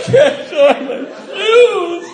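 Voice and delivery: put-on high, slow, wailing drunk whine; high drawn-out comic sob-whine; mock drunk crying. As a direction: whimper it like a weepy drunk kid, laughing. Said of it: "I was crying on the doorstep".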